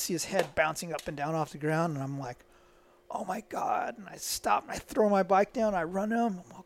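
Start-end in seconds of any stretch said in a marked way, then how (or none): 3.1-4.0 high, whispered gasp of dread; stunned whispered reaction; horrified whisper. Retold or imitated. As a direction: whisper it as if watching a terrible crash. Retold horror at friend's crash.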